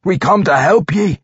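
high, urgent dwarf voice; insistent high-pitched appeal; pleading dwarf. A narrator's reading. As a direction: plead with a lost friend to accept help. Dwarf pleading with vampire friend.

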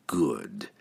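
very low, soft villain's purr. A narrator's reading. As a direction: purr it with quiet satisfaction. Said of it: "Entreri purred".

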